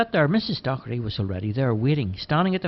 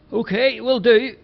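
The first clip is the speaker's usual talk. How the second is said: called back; 12 semitones higher, 8 dB louder than usual. A narrator's reading. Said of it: "Bobo responded".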